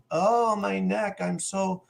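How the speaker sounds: slow, high mock moan; feigned injury. Driver deciding to fake injury to the cop, slow mock moan. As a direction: imitate someone inventing neck pain, drawn out. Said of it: "you can see the, the look in his eye".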